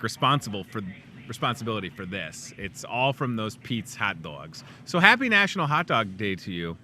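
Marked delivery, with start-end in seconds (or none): none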